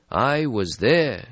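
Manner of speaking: put-on old European, slow and melancholy; weary old vampire. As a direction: sigh out an ancient regret. Stretsky voiced in story.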